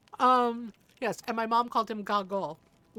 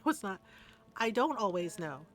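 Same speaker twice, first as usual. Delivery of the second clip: ordinary talk, nothing marked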